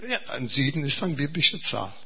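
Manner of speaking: ordinary talk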